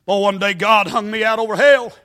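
ordinary talk

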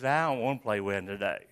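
put-on low, soft sulky child; a pouting kid turning it down; preacher voicing a sulky child who won't play; sulky refusal. Children's game quarrel acted out.

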